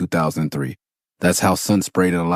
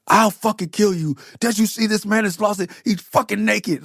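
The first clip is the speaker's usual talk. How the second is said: much higher, raging boss voice; furious outburst; 13 semitones higher than usual. Retold or imitated. Boss L snapping at the journalists, furious imitated yell. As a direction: blow up at the journalists. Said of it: "and then he lost it".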